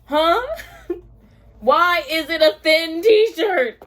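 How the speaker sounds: loud slow outraged question; incredulous yell. Real, not acted. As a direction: yell it in disbelief.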